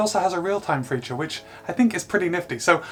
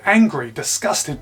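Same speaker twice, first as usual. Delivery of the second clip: ordinary talk, nothing marked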